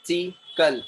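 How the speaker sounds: "Systematical" cut into separate syllables. Shown as a demonstration. Teacher models a long word for the class, syllable by syllable. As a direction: sound out "Systematical" in distinct beats. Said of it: "syllable में cut करके बोलना".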